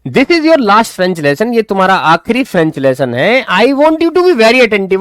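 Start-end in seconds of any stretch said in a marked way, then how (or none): 0.1-1.6 slow, deliberate textbook reading; slow reading aloud. Shown as a demonstration. Teacher reading the story slowly to students. 3.4-5.0 slow, deliberate textbook reading; slow reading aloud. Shown as a demonstration. Teacher reading the story slowly to students.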